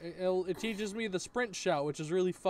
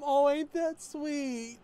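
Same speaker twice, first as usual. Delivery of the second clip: higher, slow sweet mom voice; imitating his mom; 11 semitones higher, 5 dB louder, much slower than usual. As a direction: coo it like a doting mother. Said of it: "His mom".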